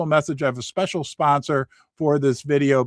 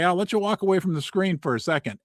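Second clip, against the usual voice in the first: normal talking voice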